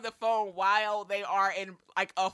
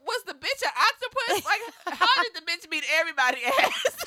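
high, loud, laughing disbelief; incredulous laughing question; 11 semitones higher, 7 dB louder than usual. Retold or imitated. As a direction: ask it cracking up, voice climbing high. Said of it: "And I said".

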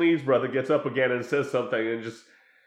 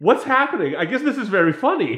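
confused viewer; 5 semitones higher, 5 dB louder than usual. Retold or imitated. Viewer reaction to the scene.

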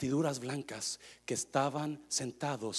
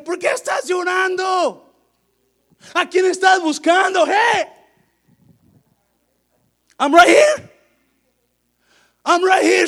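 high, full-voiced yelling; shouted appeal; 17 dB louder than usual. Jesus calling to weeping Mary beside him, shouted appeal. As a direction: shout to get through to someone not listening.

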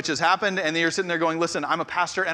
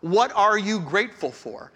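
usual speaking voice

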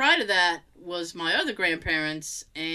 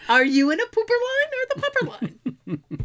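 mock usher; 13 semitones higher than usual. Mock usher asking guests.

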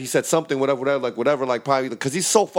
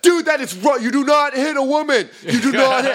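louder, much higher scolding; mock righteous hipster; 9 semitones higher, 5 dB louder than usual. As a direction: preach like an outraged hipster.

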